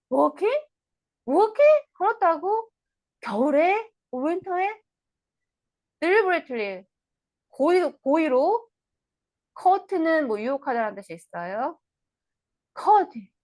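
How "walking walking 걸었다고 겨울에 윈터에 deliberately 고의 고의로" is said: high, loud disbelieving echo; incredulous mother. Acted. Mother questioning her daughter's winter walk, high and loud in disbelief. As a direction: she can't believe her ears.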